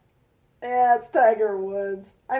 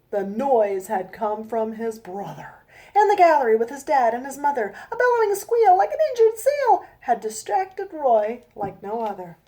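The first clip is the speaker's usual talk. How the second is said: storybook reading; 5 dB louder than usual. A narrator's reading. Reads her rhyming picture book.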